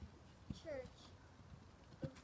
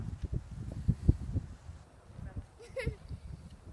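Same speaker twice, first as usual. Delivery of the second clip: a light unvoiced giggle; breathy giggle; 6 semitones higher, 10 dB louder than usual. Real, not acted. Child giggling mid-story at her own reading. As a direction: giggle breathily between words.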